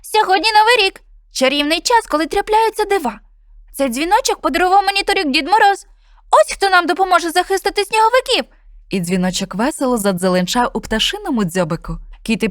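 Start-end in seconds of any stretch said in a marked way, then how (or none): 0.0-8.4 much higher, louder bird voice on "Новий рік"; bullfinch explains magic. Acted. Bullfinch tells friends about the magic bell, high bird voice. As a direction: chirp "Новий рік" bright and high with wonder.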